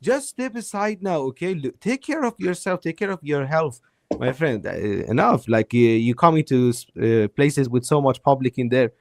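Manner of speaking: normal talking voice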